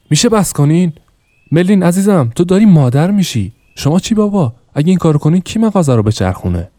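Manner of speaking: angry outburst. Acted. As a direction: lose his temper at the whole family. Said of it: "با عصبانیت گفت". (فریاد عصبانی و بلند)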